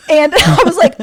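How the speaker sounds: loud laugh breaking the words. Real, not acted. Amused by Kim's savage line, laughing out loud. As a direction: laugh through it.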